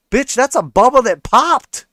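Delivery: scoffing outburst. Real, not acted. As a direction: blurt it out in disbelief. Scoffing retort to the quote.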